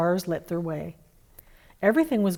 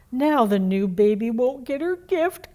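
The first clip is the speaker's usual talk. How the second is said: worried gulp; 5 semitones higher, 5 dB louder than usual. A narrator's reading. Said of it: "gulped Deer".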